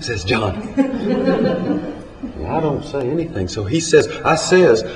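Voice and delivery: folksy storytelling verse with deliberate pauses; comic story poem recitation. Acted.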